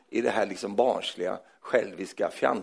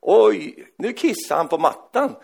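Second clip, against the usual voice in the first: ordinary talk, nothing marked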